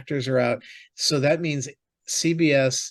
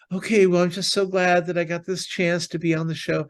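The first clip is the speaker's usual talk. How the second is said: higher-pitched mock-grateful contestant; gracious eliminated contestant; 5 semitones higher than usual. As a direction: imitate a loser being oh-so-glad, pitched up. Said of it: "on these shows where they go".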